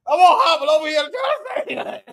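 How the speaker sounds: laughing. Real, not acted. Commentator cracking up at his own bit, laughing. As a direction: crack up halfway through the words.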